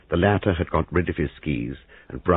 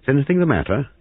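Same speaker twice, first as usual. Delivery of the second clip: sharp, raised-pitch question; 10 semitones higher, 4 dB louder, faster than usual. A narrator's reading. Turning suddenly to ask about trouble, sharp. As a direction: put the question sharply and high. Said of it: "Then he added sharply".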